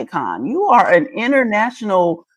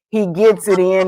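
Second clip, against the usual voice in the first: ordinary delivery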